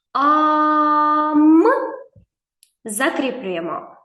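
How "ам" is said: «Ам» held long, loud and higher; drawn-out syllable. Shown as a demonstration. Teacher reading syllables aloud with the boy. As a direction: sing out «Ам» slowly. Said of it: "читай разом зі мною".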